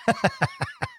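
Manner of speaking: squeaky high-pitched laugh; high giggle. Real, not acted. Host laughing at his own silly meow, high giggle.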